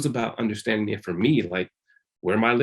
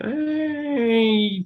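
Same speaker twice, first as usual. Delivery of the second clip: eager high hey; 12 semitones higher than usual. Retold or imitated. Excited at touching girls idea.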